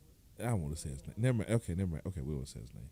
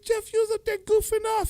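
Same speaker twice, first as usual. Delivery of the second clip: indignant; 7 dB louder, faster than usual. Retold or imitated. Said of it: "I was like".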